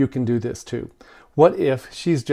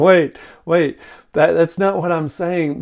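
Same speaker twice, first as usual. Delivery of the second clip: mildly pleading retold objection, higher; urgent protest; 4 semitones higher, 6 dB louder, slower than usual. Wife correcting her upset husband, mildly urgent protest. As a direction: cut in to clear up a misunderstanding, lightly acted.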